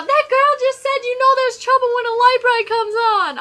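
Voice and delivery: high, loud mock screaming; full-volume high-pitched yell, acted out; imitated loud scream. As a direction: shriek the whole line, imitating an outraged stranger. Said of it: "she screams really loud".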